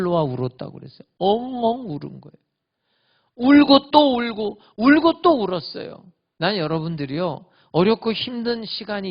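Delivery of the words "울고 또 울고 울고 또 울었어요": higher, louder, slow repetition; preacher's lamenting swell; fervent slow cry. Acted. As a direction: stretch out each 울고 like a lament. Emphatic retelling of weeping.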